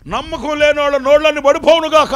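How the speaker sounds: loud, high thundering decree; angel's curse. Retold or imitated. Angel cursing the unbelievers, loud and high. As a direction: pronounce it like a judgement.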